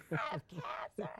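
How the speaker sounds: very high mock wail; imitated screaming. Patient calling her parents, high-pitched screamed wail. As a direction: squeal it out like a hysterical phone call. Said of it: "I called my parents screaming again".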